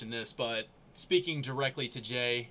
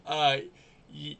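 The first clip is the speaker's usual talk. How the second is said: ordinary talk, nothing marked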